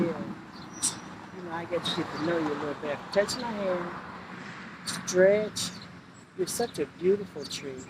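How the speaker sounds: hushed, tender sweet talk to a tree; soft sweet talk. Real, not acted. Praising the tree she touches, hushed sweet talk. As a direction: woo the tree softly.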